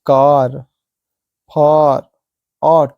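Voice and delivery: "कार, फार, ऑट", each with a long aa; teacher's word drill on aa in "कार, फार, ऑट", one word at a time; long aa sound. Shown as a demonstration. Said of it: "अ की मात्रा आनी चाहिए".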